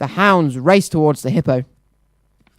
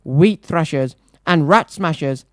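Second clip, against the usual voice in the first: usual speaking voice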